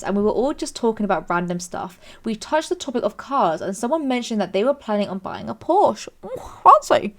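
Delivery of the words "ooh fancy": high, loud "ooh" as if impressed; playfully dazzled, high-pitched; mock-impressed. Real, not acted. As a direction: coo at something posh, pitched way up. Mock-impressed reaction to Porsche.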